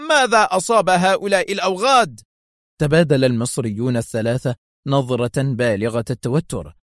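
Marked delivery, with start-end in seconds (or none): 0.1-2.2 shouting. Acted. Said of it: "صائحا".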